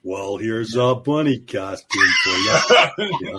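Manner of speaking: slow, low cowboy drawl; John Wayne. Acted. Doing the star in a bunny costume, slow drawl. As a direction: drag it out like a movie cowboy. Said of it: "John Wayne was in it".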